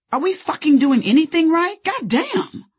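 high, loud angry outburst; losing it. A narrator's reading. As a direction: blow up at the boss, pitch soaring. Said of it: "I lost it on him".

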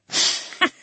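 short manic cackle; maniacal laugh. Acted. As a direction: cackle like a madwoman. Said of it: "She laughed maniacally".

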